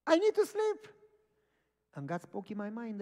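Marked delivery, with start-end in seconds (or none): 0.1-0.9 high, loud mock whine to God; loud protest. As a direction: plead loudly, pitched way up. Retold protest to God, pleading.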